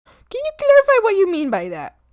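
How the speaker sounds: ordinary talk, nothing marked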